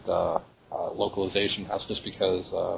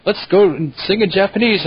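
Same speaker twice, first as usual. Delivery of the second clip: high-pitched; 10 semitones higher, 4 dB louder than usual. Retold or imitated. Quoting Lisa Ortiz's line.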